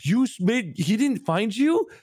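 high, astonished disbelief; amazed brother. Retold or imitated. Brother amazed the kid survived, high astonishment. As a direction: gasp it out in disbelief. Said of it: "It's like".

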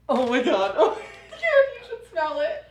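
a real laugh breaking through, pitch leaping way up; laughing disgust. Real, not acted. Smelling the dried fish snack and laughing at it. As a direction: laugh it out, can barely get the words through.

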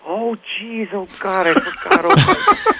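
imitated dismayed groan, high-pitched; Ron. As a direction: mimic a man realizing he forgot, voice climbing high. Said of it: "I hear Ron's voice go".

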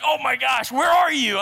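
high, loud worried cry; alarmed shout. Acted. Preacher voicing his friend who fears a wreck, alarmed shouting. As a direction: rise into an urgent acted shout.

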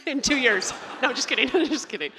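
high, laughing tease. Real, not acted. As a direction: joke through real laughter. Joking about the host's compliment.

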